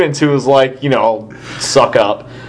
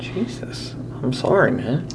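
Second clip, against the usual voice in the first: sheepish; 3 semitones lower, 10 dB quieter than usual. Real, not acted. Scolded, apologizing quietly.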